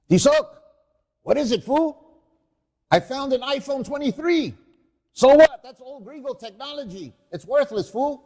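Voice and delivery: loud, high street thugs. Acted. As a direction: bark it like desert scavengers. Scavengers bicker in the desert.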